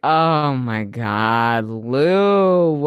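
low, drawn-out cringe; stretched-out, weary mock dismay. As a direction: groan it slowly in secondhand embarrassment. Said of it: "I was like".

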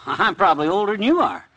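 ordinary talk, nothing marked

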